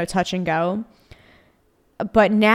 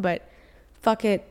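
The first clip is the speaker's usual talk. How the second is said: usual speaking voice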